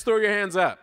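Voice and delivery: normal talking voice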